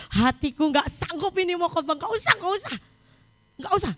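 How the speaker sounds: climbing to a high, exasperated protest; upset refusal. Retold or imitated. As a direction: act out a worked-up refusal, pitch rising. Acts own upset refusal to preach. (menirukan penolakan kesal, nada makin tinggi)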